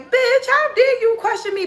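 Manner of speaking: sky-high, offended snap; indignant retort. Retold or imitated. Retold retort to boyfriend.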